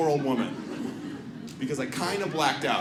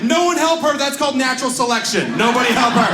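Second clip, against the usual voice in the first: louder, higher yelling over her; mock callous yell; 5 semitones higher, 6 dB louder than usual. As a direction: ham up shouting at the crowd. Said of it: "And was like".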